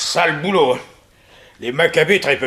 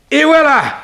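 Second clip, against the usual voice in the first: triumphant; 12 semitones higher, 5 dB louder than usual. Acted. Secret compartment opens.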